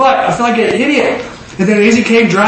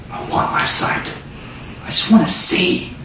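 soft, near-whispered acted plea; hushed plea; 7 dB quieter than usual. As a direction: plead it almost under your breath. Voicing blind man's plea to Jesus.

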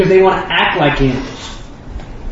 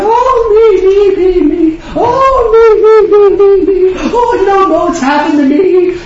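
much higher, loud, hammed-up whining wail; mock self-pitying whiner; 12 semitones higher, 4 dB louder, slower than usual. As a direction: moan about yourself like a martyr, overdone. Mocking self-absorbed unhappy people.

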